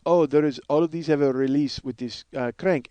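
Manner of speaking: Russian repo man explaining; halting Russian-accented explanation. Acted. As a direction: do the Russian guy's voice. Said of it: "I love doing this Russian guy's voice".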